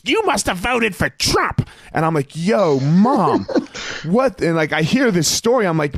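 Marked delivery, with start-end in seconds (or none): 0.0-1.7 mom's accusing voice. Retold or imitated. Mom scolding strangers at play. 2.3-4.4 incredulous. Retold or imitated. Son reacting to mom's story.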